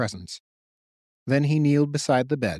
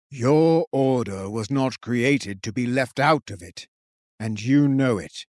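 bellowing king; slower than usual. A narrator's reading. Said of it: "the king bellowed with unexpected strength".

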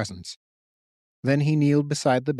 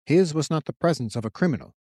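ordinary delivery

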